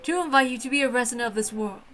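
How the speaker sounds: usual speaking voice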